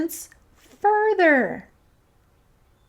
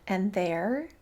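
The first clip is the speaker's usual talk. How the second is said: ordinary delivery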